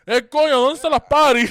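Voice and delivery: loud mock Cuban street Spanish. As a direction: ham up a Cuban accent to charm the driver. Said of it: "if I start speaking Cuban Spanish".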